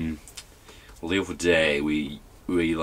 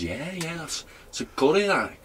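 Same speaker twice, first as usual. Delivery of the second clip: high, much higher imitation of mum; mock mum voice; 10 semitones higher than usual. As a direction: voice mum in a squeaky put-on pitch.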